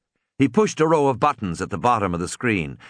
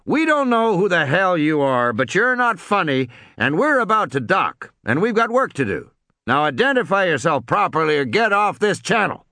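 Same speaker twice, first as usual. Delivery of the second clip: drawled reply; 7 semitones higher than usual. Acted. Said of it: "came the drawled reply".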